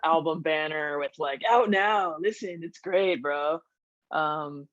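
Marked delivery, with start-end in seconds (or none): 1.5-3.6 put-on laid-back California surfer accent. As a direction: sell the ad like a chill surfer bro. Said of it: "I sounded like a surfer".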